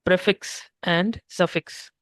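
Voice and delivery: "Prefix and suffix" spelled out slowly, each word apart; slow and clear. Shown as a demonstration. Tutor slowly repeating the terms for a confused student. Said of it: "What do you mean by these terms".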